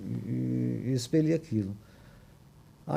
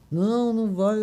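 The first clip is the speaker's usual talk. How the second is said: high, loud retold denial; insistent denial; 9 semitones higher, 4 dB louder than usual. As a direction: deny it flat out, refusing to hear it. Sister-in-law insists he's cured.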